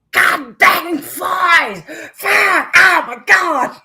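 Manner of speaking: very high, loud, slow furious yelling; imitated rant. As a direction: rage at the flies like the Winnebago man. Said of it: "he's like".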